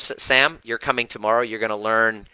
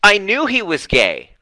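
mimicking Tina; 10 semitones higher, 6 dB louder than usual. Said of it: "And she said".

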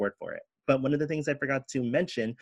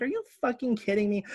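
incredulous; 12 semitones higher, faster than usual. Retold or imitated. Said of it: "I was just like".

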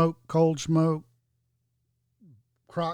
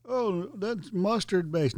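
teasing impression of people who get it wrong; mocking tone; 6 semitones higher, slower than usual. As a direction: drag it out like a naive claim you scoff at. Mocking a common belief.

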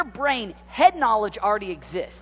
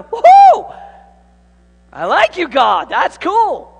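excited whoop; 9 semitones higher, 12 dB louder, slower than usual. Retold or imitated. Excited reaction to a dream.

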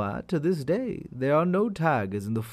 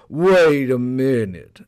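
louder, slow alarmed call; alarmed tiger; 7 dB louder, slower than usual. Acted. Tiger calls out to hare, louder and alarmed. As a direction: stop him short.

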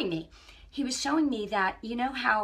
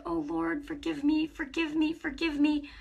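pleading prayer; 7 semitones higher than usual. Retold or imitated. Begging the Lord's pardon.

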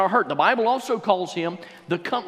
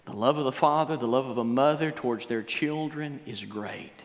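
hushed altar appeal; 8 semitones lower, 10 dB quieter than usual. Acted. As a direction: draw them in gently, almost a murmur. Quiet altar appeal after story.

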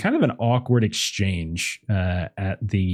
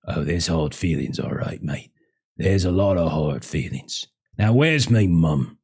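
low, grudge-bearing son's voice; resentful Hollis; 4 semitones lower than usual. Acted. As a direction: answer him low, holding the grudge. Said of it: "Hollis just kind of looks at him and shakes his head".

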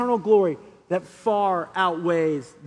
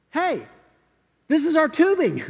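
excited discovery; 7 semitones higher, 4 dB louder than usual. Retold or imitated. Excited worker spots his product.

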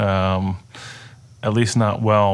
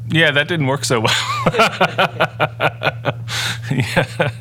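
laughing it off, a bit higher and brighter; rueful laugh; 6 semitones higher than usual. Real, not acted. Answering his friend with a rueful laugh. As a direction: chuckle at his own failure.

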